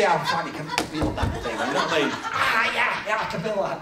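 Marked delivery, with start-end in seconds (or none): 2.1-3.8 laughing mock reply. Mimics paralysed man, toe flicked.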